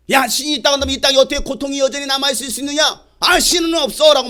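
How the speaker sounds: quick, high-pitched challenge of an atheist; arguing atheist. Retold or imitated. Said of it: "말하는 사람이에요". (무신론자처럼 높고 빠르게 따지듯)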